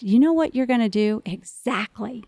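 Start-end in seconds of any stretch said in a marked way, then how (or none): 1.3-2.2 a quick knowing whisper; whispered, voiceless one-word aside. Real, not acted. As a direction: breathe it out as a secret aside.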